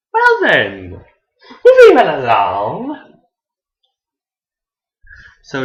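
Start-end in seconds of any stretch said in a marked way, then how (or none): 0.1-3.2 loud, high singsong dodge, drawn out at the end; awkward brush-off. Acted. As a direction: breeze past the awkwardness theatrically. Acts awkward reaction, changing the subject.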